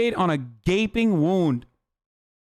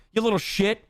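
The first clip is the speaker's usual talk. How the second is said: normal talking voice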